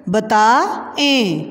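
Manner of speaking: बताएं with the final ए stretched slowly; बताएं, slow and drawn so the ए is heard; drawn-out ए ending. Shown as a demonstration. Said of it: "ए की sound होती है".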